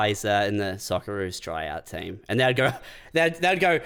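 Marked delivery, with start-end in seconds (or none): 0.0-2.2 low, understated dry reply; imitating a deadpan brush-off. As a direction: deliver it low and straight-faced. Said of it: "You'd deadpan them".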